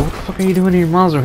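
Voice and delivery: fast, high, baffled blurt. Retold or imitated. To his friend walking into his mom's room, quick high baffled question. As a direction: rush it out in confused alarm.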